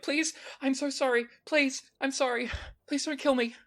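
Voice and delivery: high, panicked pleading; terrified young cultist pleading; begging cultist. Acted. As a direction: beg for your life in a high voice. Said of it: "She's like".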